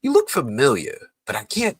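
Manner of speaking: bad impersonation with a theatrical Broadway accent; Harold. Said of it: "this is my bad Harold in-, impersonation".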